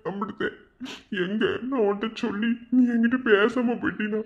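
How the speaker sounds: high, strained lament with pauses; pained, pleading inner monologue; anguished confession. Acted. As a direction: pour out what you cannot tell her. Anguished unspoken confession to his beloved.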